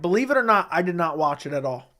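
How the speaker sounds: normal talking voice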